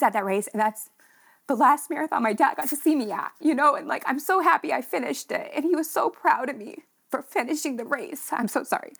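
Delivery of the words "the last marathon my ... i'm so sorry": choked up, voice lifting higher; fighting back tears while remembering her dad; emotional. Real, not acted. Talking about her late dad at his last race, near tears. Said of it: "I get so emotional about it".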